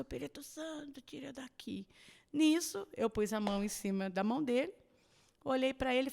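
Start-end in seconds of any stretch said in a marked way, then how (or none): 0.0-1.8 imitating a barely audible plea, much softer; faint plea. Sick father murmuring to the Holy Spirit, barely audible. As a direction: let it almost vanish into a murmur. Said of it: "ele começou".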